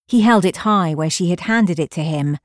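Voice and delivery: usual speaking voice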